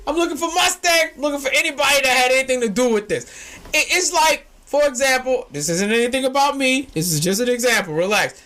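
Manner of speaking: ordinary delivery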